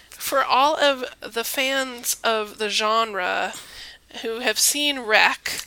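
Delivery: slow, laboured enunciation; trouble forming words. Real, not acted. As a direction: get each word out slowly and carefully. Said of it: "I'm having trouble forming the words".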